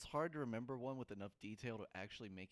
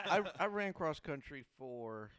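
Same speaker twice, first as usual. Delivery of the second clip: put-on slow, louder old-man drawl through a laugh; grandpa voice; 6 semitones higher, 6 dB louder, slower than usual. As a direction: talk like a rambling old grandpa. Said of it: "switched over to my grandpa voice".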